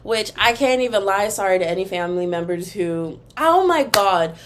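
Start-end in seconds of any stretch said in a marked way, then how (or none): 3.4-4.4 surprised. Real, not acted. Catches her own slip.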